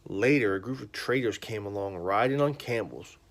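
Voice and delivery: put-on loud, lively storybook reading to a child; reading to children. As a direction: read it big and animated for the kids. Reads a children's Bible story.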